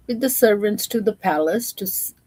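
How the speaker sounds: ordinary delivery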